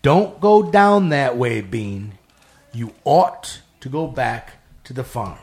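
slow, loud, drawn-out warning, dropping on Bean; another, otherworldly voice. Retold or imitated. Street lady suddenly warns the narrator in another voice, slow and commanding. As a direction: deliver it as a weighty, measured pronouncement. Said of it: "in another voice".

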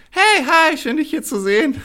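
very high squeezed voice; shrinking down. Shown as a demonstration. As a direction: squeeze the voice up into a tiny high chirp. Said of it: "Sonst würde ich nur noch so drücken".

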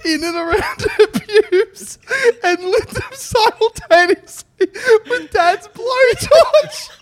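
reading through helpless laughter; words squeezed out between laughs; crying with laughter. Real, not acted. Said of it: "fucking crying".

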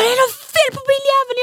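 high, loud mock yell; angry outburst. Partner on the phone about the car, retold angry outburst.

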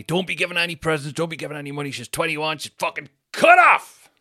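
high-pitched rant building to a shout; imitated scolding auntie. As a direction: lay down the law, exploding on the last words. Retold auntie laying down the law.

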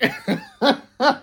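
high, real peal of laughter; uncontrolled high giggling burst; big laugh. Real, not acted. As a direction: keep cracking up, high-pitched. Host still laughing at caller's story.

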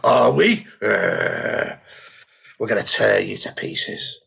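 low, menacing mock crowd voice; hostile audience. Audience turning on the comic, mock menacing. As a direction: drop low and threaten, slightly hammed-up. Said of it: "then the audience go".

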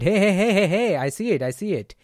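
high, loud hey hey hey, dropping on the last I see it; energetic hey to calm a charged person; matching energy. Shown as a demonstration. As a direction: meet their heat, then come down. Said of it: "the energy is a bit different".

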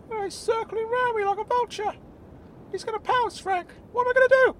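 very high, panicked character voice; Claude. Acted. Claude trapped by circling bull.

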